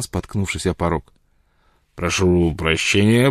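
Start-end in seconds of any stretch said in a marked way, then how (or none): 1.9-3.3 put-on slow, drawn-out drunken apology; smooth things over in a slurred drawl; tipsy, soothing muttering; placating mumble. A narrator's reading. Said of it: "бормотал Джек Корнелл умиротворяюще".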